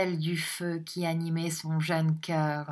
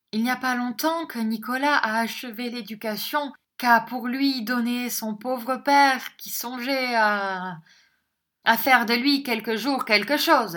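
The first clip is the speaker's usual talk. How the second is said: higher, louder, plaintive woman's voice; mother defending her son; 7 semitones higher, 6 dB louder than usual. Acted. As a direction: plead the son's case, pitched up. (voix de mère, aiguë et plaintive)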